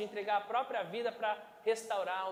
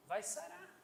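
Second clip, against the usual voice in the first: Vai sarar repeated much softer, rising at the end; soft echo; 8 semitones higher, 10 dB quieter than usual. Shown as a demonstration. Preacher gently repeats the healing promise, softly. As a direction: let Vai sarar fall to a gentle hush. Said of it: "softer echo".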